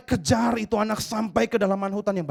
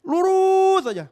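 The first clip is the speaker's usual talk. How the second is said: high, loud and slow on lurus; emphatic straight; 7 dB louder, much slower than usual. Shown as a demonstration. Mimicking pig running straight.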